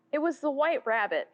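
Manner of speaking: usual speaking voice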